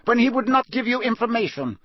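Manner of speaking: high, hard judge's voice; cold judge reading charges; stern judge. Acted. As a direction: press the charges without mercy. Crouch continues charges.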